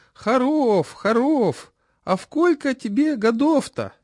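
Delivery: put-on high, slow lisping old man; toothless old man. A narrator's reading. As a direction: mumble slowly through gums. Chairman voiced.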